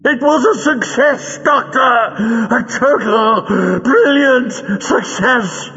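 very high, drawn-out villain screech; frenzied denial. A narrator's reading. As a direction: insist hysterically, out of wounded vanity. Vain Greel furiously denies failure.

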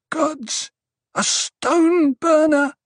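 high, slow frightened whimper. A narrator's reading. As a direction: whimper it in dread. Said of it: "someone whimpered".

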